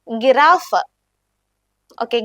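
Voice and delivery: ordinary delivery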